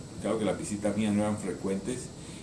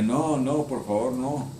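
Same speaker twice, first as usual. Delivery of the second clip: higher, mildly frightened pleading; retold fearful begging, pitched up; mock scared child; 4 semitones higher, slower than usual. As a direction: plead lightly like a scared little boy. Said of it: "Alvarito se asustaba".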